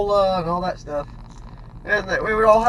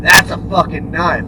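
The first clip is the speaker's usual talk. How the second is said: louder, hard menacing snarl; menacing boss; 3 semitones lower, 6 dB louder than usual. Retold or imitated. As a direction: threaten with the knife at his throat. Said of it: "put it up against my throat, and said".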